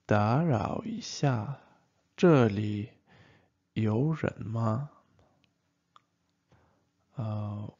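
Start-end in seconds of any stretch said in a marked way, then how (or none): 0.0-4.9 打扰一下，这里有人吗 said slowly and carefully; slow it down so learners can follow each syllable; a careful classroom model, taken slowly; slow repeat. Shown as a demonstration.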